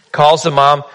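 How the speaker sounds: ordinary talk, nothing marked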